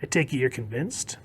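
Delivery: hushed villain, fading to a near whisper; creepy. Acted. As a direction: sink softly into sinister relish. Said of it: "got a little more creepy".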